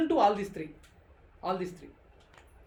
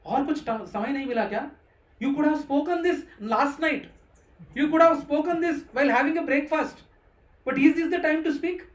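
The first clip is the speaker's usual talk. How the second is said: mock scolding, growing louder; Krishna scolding Arjuna; 3 semitones higher, 5 dB louder than usual. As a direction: tease Arjuna for his bad timing. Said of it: "when Krishna is saying like this".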